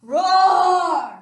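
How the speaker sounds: big shouted dinosaur roar, pitched high; loud playful dinosaur roar. Acted. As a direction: roar it out full force. Dinosaur roars again.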